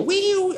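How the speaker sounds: high siren wail imitating an alarm; a quick mock alarm-light whoop. Preacher mimics a warning light for the congregation, high siren whoop. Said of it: "Así".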